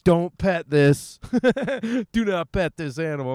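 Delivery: slow, loud and high mock warning with a laugh breaking in. Vlogger mocks the airport dog sign, slow and loud. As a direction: bark it out slowly and loudly like an oversized warning sign. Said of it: "you gotta put it, like".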